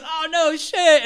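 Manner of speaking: high, loud acted dismay; startled gang member. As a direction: exclaim high in mock alarm. Gang member reacts to near miss.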